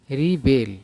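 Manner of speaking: रिबेल with stress on the second syllable बेल; रिबेल, light रि then strong बेल; say riBEL. Shown as a demonstration. Teacher modelling रिबेल as the verb, stress at the end. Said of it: "तब क्या हो जाएगा".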